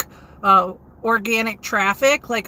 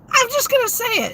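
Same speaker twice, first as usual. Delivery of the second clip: excited; 12 semitones higher, faster than usual. Real, not acted. Blurting out a frank remark.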